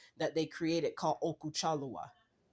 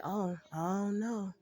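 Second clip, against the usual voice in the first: playing it cool. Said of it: "play that part real cool. Act like".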